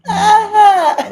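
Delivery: high loud cry of aha; imitated big aha. Mimicking the neighbour's yell after the song, high and loud. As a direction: belt out a wild high aha. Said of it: "the big ahas after the song".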